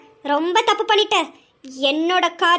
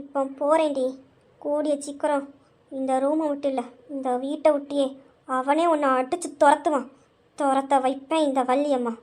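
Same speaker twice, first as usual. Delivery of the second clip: lower, much softer, venomous promise; low menacing threat; 4 semitones lower, 5 dB quieter than usual. Acted. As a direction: hiss the threat close. Grandmother's low menacing parting threat.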